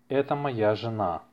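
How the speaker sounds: ordinary talk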